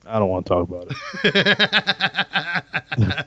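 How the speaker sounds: slow, drawn-out mock sulk; mock sulky. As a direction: refuse it with exaggerated reluctance. Asked about cleaning up mess.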